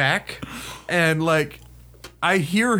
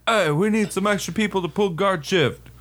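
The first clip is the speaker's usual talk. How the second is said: lightly voiced sergeant, higher than usual; imitated sergeant calling out; 5 semitones higher than usual. First sergeant walks in seeking extra guards, imitated call-out.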